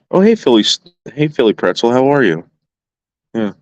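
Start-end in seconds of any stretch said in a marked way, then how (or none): 0.1-2.5 loud, high, cheerful hello across the yard; calling out. Real, not acted. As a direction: call out a greeting to a neighbour. Greeting a neighbour across yard.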